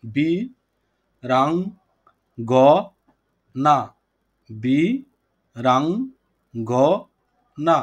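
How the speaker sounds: "বি রাং গ না" syllable by syllable, long pause after each; slow syllable breakdown. Shown as a demonstration. Teacher showing the class how to split a word, "বি রাং গ না" slowly syllable by syllable. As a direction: break "বি রাং গ না" into four separate beats. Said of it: "ধীরে ধীরে উচ্চারণ".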